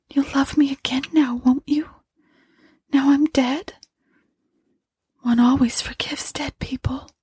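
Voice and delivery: high, hollow, breathy whisper; thin monotonous pleading of a dead wife; weak, faint ghost voice. Acted. Dead wife pleads with her terrified husband, weak and faint. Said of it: "it was very weak and faint now".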